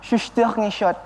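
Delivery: Suster ngesot with French sh sounds; Frenchified ghost name; French accent. Shown as a demonstration. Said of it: "kalau bahasa Perancisnya?".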